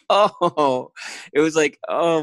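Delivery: high, drawn-out smitten "oh"; loud, lifted swooning sigh of delight; mock swoon. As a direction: let it out like a heart melting. Retold swooning reaction to her.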